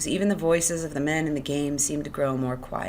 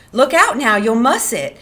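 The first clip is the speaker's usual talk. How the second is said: high, loud annoyed protest; sharp warning; 8 semitones higher, 11 dB louder than usual. Acted. Curley's wife protecting her hair, sharp warning. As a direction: warn him off loudly.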